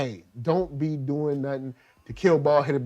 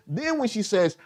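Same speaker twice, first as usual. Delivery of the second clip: ordinary talk, nothing marked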